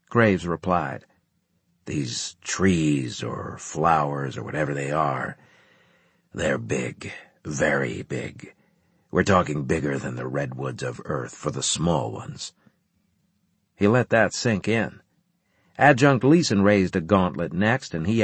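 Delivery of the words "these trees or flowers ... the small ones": grave commander explaining. A narrator's reading. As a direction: let the size sink in, low and unhurried.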